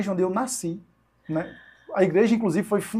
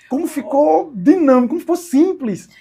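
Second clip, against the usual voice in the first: amazed delight; 8 semitones higher, 6 dB louder than usual. Real, not acted. Marvels at how easy it became.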